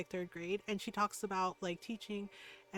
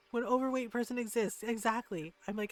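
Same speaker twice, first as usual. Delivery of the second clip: ordinary talk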